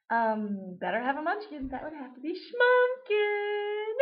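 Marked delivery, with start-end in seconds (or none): 1.7-4.0 high and slow, breaking into giggles; can barely get the name out for laughing. Real, not acted. Naming the Munchkin while laughing helplessly.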